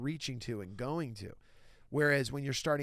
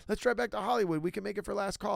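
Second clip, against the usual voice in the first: excited impression of Dom; 8 semitones higher, 5 dB louder than usual. Imitating Dom pushing on.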